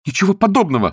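high-pitched protest; indignant cry. A narrator's reading. Niece defending fiancé to uncle, high cry. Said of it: "вскричала Анжела".

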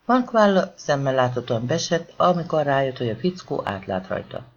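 ordinary delivery

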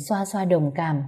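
ordinary delivery

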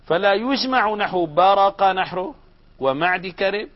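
normal talking voice

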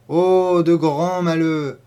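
high, loud, drawn-out exclamation; emphatic lament. A narrator's reading. Farmer's wife asked about the maid's misfortunes, emphatic. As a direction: stretch it out, pitched high.

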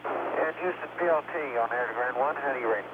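soft, distant headset voice; crackly comm-loop transmission; helmet radio. Real, not acted. Crew voice check over helmet radio.

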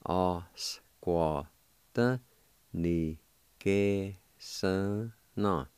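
very slow, drawn-out Osquataniguesana; slow syllable by syllable. Shown as a demonstration. Teacher joining both parts slowly for students. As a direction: stretch out every part of Osquataniguesana.

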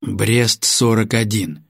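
slow, deliberate password; clearly enunciated. Acted. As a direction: spell out each word of the password. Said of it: "чётко произнёс". (чётко и медленно произнесённый пароль)

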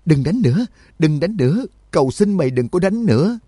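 high-pitched, pleading cry; panicked scream. A narrator's reading. Binli begs the lightning to stop striking, screaming in panic. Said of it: "hét toáng lên".

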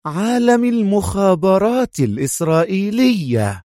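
high, emphatic venom; intensified loathing. A narrator's reading. Sonia completes her threat to Adham, redoubled loathing. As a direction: raise the pitch and spit contempt. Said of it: "وتضاعف المقت في صوتها".

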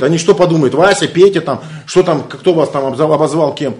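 ordinary talk

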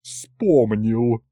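sour mocking echo in a matron's voice; disgruntled, put-on grumble; grumbling. A narrator's reading. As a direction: throw the word back grumpily. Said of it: "проворчала она".

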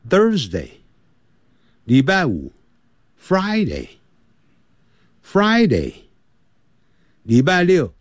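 each day name stretched slow and clear; slow repeat-after-me. Shown as a demonstration. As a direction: drill the days slowly for pupils. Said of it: "我们现在就跟着讲". (慢速领读星期)

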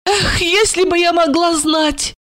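high-pitched plaintive woman; frightened tipsy lament. A narrator's reading. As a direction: wail your fear in a high voice. Drunk woman laments her fear.

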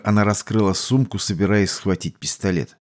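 ordinary delivery

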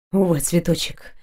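low, hoarse man's voice; a hint of a hoarse knight; hoarse reply. A narrator's reading. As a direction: answer her roughly, from low in the throat. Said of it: "хрипло ответил Дайрен".

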